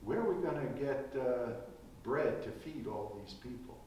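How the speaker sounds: Jesus leaning over, speaking softly to us. Retold or imitated. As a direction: murmur it as if leaning over to someone. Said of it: "Jesus leans over to us and says".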